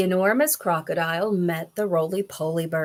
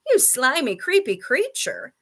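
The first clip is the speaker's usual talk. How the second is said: higher-pitched disgusted scolding; indignant cry; 6 semitones higher than usual. A narrator's reading. Monkey berates the crocodile, crying out indignantly. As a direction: spit insults in high outrage. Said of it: "cried Mugglewump".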